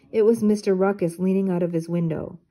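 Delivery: plain reading voice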